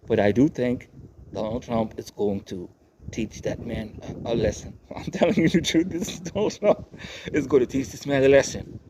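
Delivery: normal talking voice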